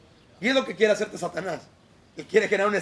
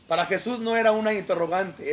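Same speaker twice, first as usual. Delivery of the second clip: usual speaking voice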